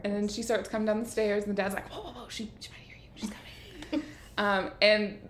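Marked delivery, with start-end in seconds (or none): 1.9-4.0 soft, urgent hush; acted-out hushed alarm; hushed warning. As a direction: drop the volume to warn them. Dad warns others to hush.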